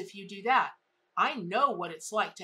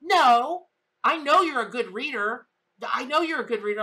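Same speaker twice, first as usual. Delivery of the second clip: loud, raised pleading protest; insistent client; 5 semitones higher, 10 dB louder than usual. Retold or imitated. Upset client protesting to the reader, loud and insistent. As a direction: insist hard, almost begging.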